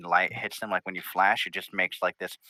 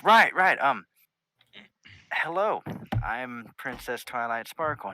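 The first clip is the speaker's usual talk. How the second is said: higher-pitched princess, startled then politely hesitant; Twilight Sparkle, flustered; 3 semitones higher than usual. Acted. Princess introduces herself to the visitors, flustered. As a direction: recover with a halting, formal welcome.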